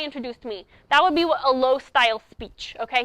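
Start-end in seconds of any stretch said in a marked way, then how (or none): none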